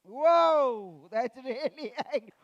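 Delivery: loud drawn-out whoa; mock-impressed whoa. Real, not acted. Storyteller loudly marvelling at a child's angry face. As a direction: act amazed at the fierce face.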